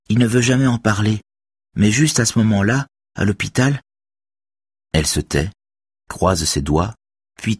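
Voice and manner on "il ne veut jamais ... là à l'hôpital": put-on higher girl's voice, earnest and firm; teen girl voice. A narrator's reading. Girl defends Axel to Robert, higher earnest voice. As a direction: voice the young girl defending him. Beverly's voice.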